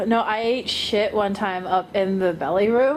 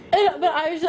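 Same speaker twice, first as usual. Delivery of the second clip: ordinary talk, nothing marked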